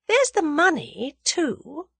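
high, musing remark; thoughtful. A narrator's reading. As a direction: muse aloud, pitched well up. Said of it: "she observed thoughtfully".

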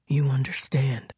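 low, menacing whisper; cold whisper. A narrator's reading. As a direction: whisper it coldly. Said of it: "he said coldly".

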